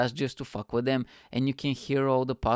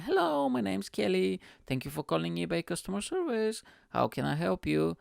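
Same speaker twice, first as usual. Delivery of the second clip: high, super positive phone greeting; high-pitched bubbly operator; bubbly customer service girl; 7 semitones higher than usual. Acted. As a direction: chirp it like a perky call-centre agent. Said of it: "super positive and bubbly girl".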